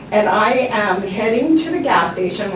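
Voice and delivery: ordinary talk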